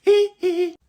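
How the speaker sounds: very high falsetto hee-hee squeals. Acted. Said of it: "je vous présente [name]".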